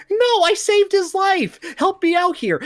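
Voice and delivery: mock pleading, far up in pitch; high-pitched protest. Hero begs the accusing henchmen to believe him, high mock protest. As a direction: squeak out a hammed-up desperate denial.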